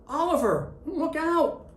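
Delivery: loud, high elf shout of warning. A narrator's reading. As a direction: yell it out to stop him falling. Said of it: "one of the elves shouted".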